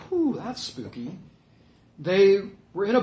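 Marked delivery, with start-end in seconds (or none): 0.1-1.2 slow, softer mock unease; mock spooked. Preacher plays down the disciples' terror, mock spooked. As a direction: draw it out softly as if mildly spooked. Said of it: "wasn't just like".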